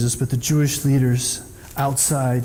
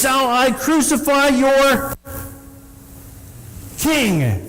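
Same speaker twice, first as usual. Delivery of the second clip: higher, slow, taunting question; mocking Pilate yell; 10 semitones higher, much slower than usual. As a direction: taunt the crowd with it. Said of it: "Pilate yells".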